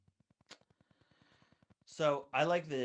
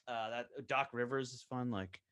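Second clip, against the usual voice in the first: normal talking voice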